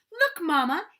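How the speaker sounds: high, loud excited shout. A narrator's reading. Little sister calling Mama to look at Gandy, loud high shout. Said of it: "Daisy shouted".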